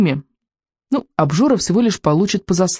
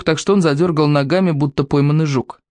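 ordinary delivery, nothing marked

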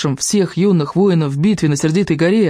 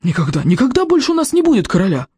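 high, mildly raised cry of protest; lightly acted shout, pitched up; crowd's cries; 6 semitones higher than usual. A narrator's reading. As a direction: cry out as one of an outraged crowd. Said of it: "Преобладали крики".

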